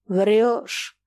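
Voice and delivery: plain reading voice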